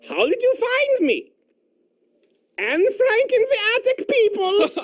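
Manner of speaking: put-on high, girlish voice reading jokes as Anne Frank. Comedian reading jokes as Anne Frank, high girl voice. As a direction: pitch way up to play the diarist girl. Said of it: "This is written from Anne Frank's point of view".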